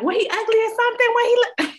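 high, loud, fast mock relatives; heckling family. Family at the car demanding a look, high heckling. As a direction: fire off nosy questions way up high. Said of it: "And they're like".

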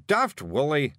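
irritated snap, in character; snapped. A narrator's reading. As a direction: cut in on a daft companion. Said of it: "snapped Rob".

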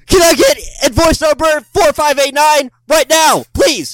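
yelling in all caps, high and loud; shouting email. Retold or imitated. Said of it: "they'll email".

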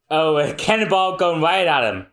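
ordinary talk, nothing marked

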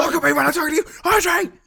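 high, loud, hammed-up scolding; mock angry shout. Scolding Audrey in a pretend quarrel, mock fury. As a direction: yell it like an overblown melodrama.

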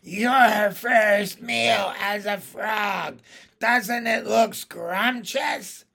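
high, slow witch voice; loud, drawn-out crone taunt; cackling hag. A narrator's reading. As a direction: gloat like a storybook witch. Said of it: "cackled again".

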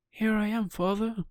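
put-on high, bright puppet boy voice; a wooden boy calling out proudly; Pinocchio voice. Pinocchio's first words, high.

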